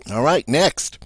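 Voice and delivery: ordinary talk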